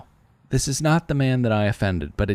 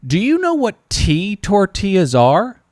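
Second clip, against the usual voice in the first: sarcastic curiosity; 9 semitones higher than usual. Retold or imitated. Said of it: "And I said".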